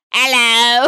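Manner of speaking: put-on very loud, drunken British holler; drunken British accent. Greeting a boyfriend found at a concert, drunk British. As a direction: bellow a trashy British greeting. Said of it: "So you turn British".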